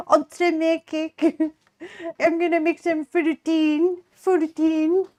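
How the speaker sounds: high, cutesy, badly faked French accent; attempted French accent. Streamer trying the uwu cake line again, badly faked French accent. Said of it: "What if I did in a French accent?".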